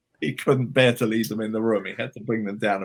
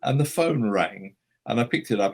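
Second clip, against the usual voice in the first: ordinary talk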